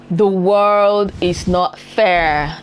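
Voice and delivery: very slow, every word stretched and firm; very slow emphasis. Real, not acted. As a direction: spell it out word by word. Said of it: "repeated line, first take".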